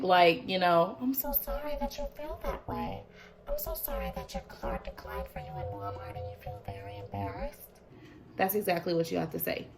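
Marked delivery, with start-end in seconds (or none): 1.0-7.8 syrupy fake-sorry customer service voice; sarcastic apology. Shown as a demonstration. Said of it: "keep being sarcastic".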